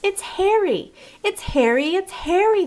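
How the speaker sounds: high, loud joyful cries; excited cry. Acted. Children recognising their dog, crying out high and loud. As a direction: shout it with glee, over and over. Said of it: "they cried".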